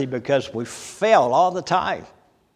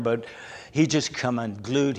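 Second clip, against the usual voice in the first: ordinary talk, nothing marked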